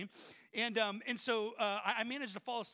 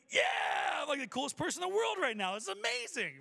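loud, high-pitched fanboy elation; thrilled; 7 semitones higher, 8 dB louder than usual. Retold or imitated. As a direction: gush like an overjoyed kid in the crowd. Thrilled at being singled out.

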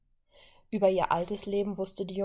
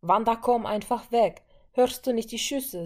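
urgent brother; 4 semitones higher, 6 dB louder than usual. Acted. Pietro urges under gunfire.

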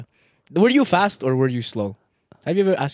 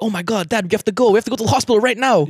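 higher, hurried mock panic; urgent mom imitation; 7 semitones higher, faster than usual. Mom telling dad the baby is coming, mock urgency. Retells mom going into labour.

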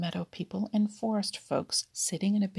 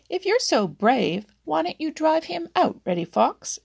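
higher, louder squirrel voice teasing; cheeky squirrel; 9 semitones higher, 6 dB louder than usual. A narrator's reading. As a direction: dare him from a safe spot. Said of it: "asked Happy Jack".

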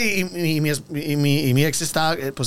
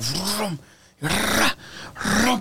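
rolled-r whoosh of fast movement; imitated whooshing noise; 3 semitones higher, 4 dB quieter, much slower than usual. Dad mimics his daughter darting across the bed, imitated whoosh. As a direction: make short rushing motion noises.

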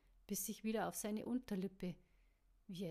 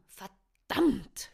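loud, scornful curse; a loud, growled curse; contemptuous growl; 15 dB louder than usual. A narrator's reading. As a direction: spit the word out in contempt. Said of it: "knurrte sie verächtlich".

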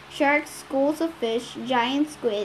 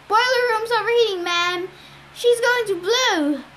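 urgent sailor; 8 semitones higher, 4 dB louder than usual. A narrator's reading. Zombie sailor reports to captain.

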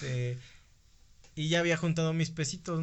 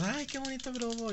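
sweet admiring voice; 9 semitones higher, 6 dB quieter than usual. Retold or imitated. Strangers admiring the balloon.

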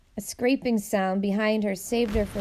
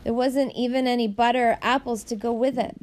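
plain reading voice

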